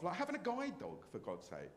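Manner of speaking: ordinary talk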